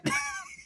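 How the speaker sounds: real squealing burst of laughter; high shrieking laugh. Real, not acted. To the viewers after the concussion joke, high shrieking laugh.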